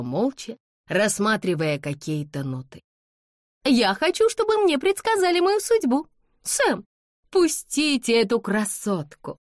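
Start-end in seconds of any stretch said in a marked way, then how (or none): 3.7-9.4 high-pitched, insistent demand; imperious young lady. A narrator's reading. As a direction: order it like a spoilt heiress. Haughty daughter demands her way. (высокий капризный приказной тон)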